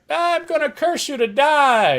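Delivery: hammed-up priest threat; high mock priest curse. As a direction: squeal the curse. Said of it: "the guy's like".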